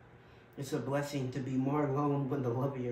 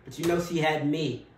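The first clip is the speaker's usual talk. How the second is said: anguished outburst; 3 semitones higher, 7 dB louder than usual. Acted. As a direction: let the hurt burst out. Grieving outburst in the monologue.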